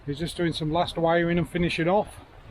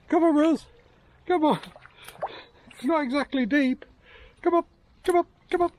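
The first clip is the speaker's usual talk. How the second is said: high coaxing call; 10 semitones higher than usual. Real, not acted. Coaxing dog across water.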